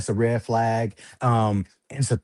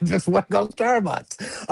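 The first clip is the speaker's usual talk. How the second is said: higher, mock whiny plea; whiny repeat; 7 semitones higher than usual. Man telling officers what he wants, higher whiny plea. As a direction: beg for Starbucks like a child. Repeats his answer to cops.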